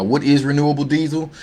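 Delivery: usual speaking voice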